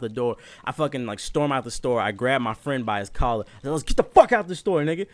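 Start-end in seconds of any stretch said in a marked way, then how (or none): 3.7-5.1 high, loud angry order; retold storming-out command; pissed off. Customer dragging his friend out of the store, pissed off. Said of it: "pissed off as fuck".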